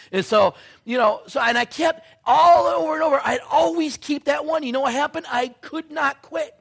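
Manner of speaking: shouted, high, crying-out sermon peak; a preacher crying out his failure; preaching at full force. Acted. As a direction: hammer the confession at the crowd.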